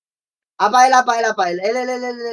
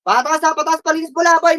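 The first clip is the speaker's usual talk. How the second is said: ordinary delivery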